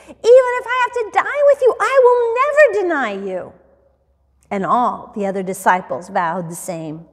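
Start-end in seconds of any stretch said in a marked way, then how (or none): 0.1-3.5 high, loud, adamant pledge; impassioned acted protest of loyalty; insistent vow. A narrator's reading. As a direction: insist at the top of your pitch. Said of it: "Peter insisted".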